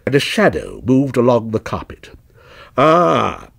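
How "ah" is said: pleased mild exclamation; a touch of stagey surprise; exclaiming. A narrator's reading. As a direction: greet a lucky arrival. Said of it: "exclaimed Mister Joshagin".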